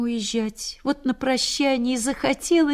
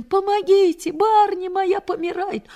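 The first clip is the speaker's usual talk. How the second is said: calling for help; 11 semitones higher, 4 dB louder than usual. Retold or imitated.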